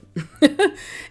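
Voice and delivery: bright, high little laugh; a quick real laugh at herself; short laugh. Real, not acted. Laughs after drinking water.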